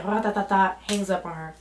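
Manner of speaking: usual speaking voice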